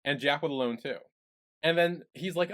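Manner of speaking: ordinary talk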